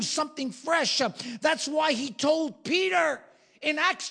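high, loud revival preaching; fiery sermon peak; full-force preaching. Real, not acted. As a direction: preach it out to the congregation.

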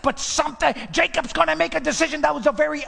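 building to high, urgent delivery; rising preaching fervor. Real, not acted. Preacher telling Jacob's turning decision, mounting fervor. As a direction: drive the story upward with mounting force. Preaching climbing to full force.

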